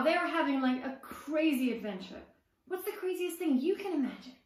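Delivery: ordinary delivery, nothing marked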